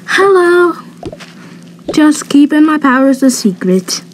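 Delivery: usual speaking voice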